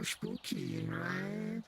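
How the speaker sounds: rising high villain taunt; spooky voice. Acted. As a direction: tease in a spooky voice. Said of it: "The spooky voice".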